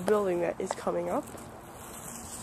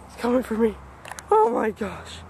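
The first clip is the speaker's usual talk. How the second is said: normal talking voice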